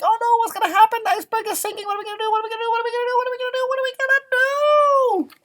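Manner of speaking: high, rising panicky cries; panicked animals. Acted. Animals clinging together on the sinking iceberg, panicky. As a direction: squeak out growing panic.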